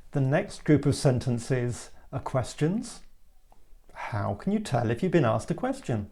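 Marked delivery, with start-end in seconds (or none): none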